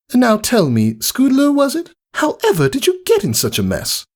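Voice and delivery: high, elegant and polite; gracious welcome; refined lady unicorn. Acted. Rarity greeting Rainbow Dash at her door, refined politeness.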